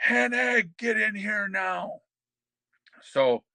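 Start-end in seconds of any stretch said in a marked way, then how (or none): 0.1-2.0 high, drawn-out yell out a door; imitated yell. Boss calling a wrestler into the office, imitated yell. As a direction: call someone in, high and stretched, mimicking the boss. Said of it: "Vince yells out the door".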